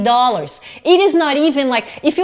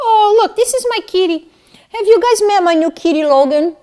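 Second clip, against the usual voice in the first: high sing-song cat talk; pet voice; 8 semitones higher, 5 dB louder than usual. Real, not acted. Showing her kitty to the viewers in a higher cooing voice.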